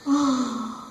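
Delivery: audible drawn-out sigh; wistful sigh. Acted. Performer looking back on corporate law, sighing. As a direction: let out a long sigh.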